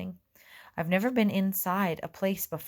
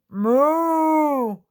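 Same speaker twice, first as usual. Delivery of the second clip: loud, higher bellowing moo; loud cow moo; 8 semitones higher, 10 dB louder than usual. A narrator's reading. Cow lows loudly.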